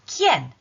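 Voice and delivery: quién said like key-en, one clear syllable; quién as kyen, firm; Spanish quién. Shown as a demonstration. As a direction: model quién loudly for repeating. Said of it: "I've put a key there for pronunciation".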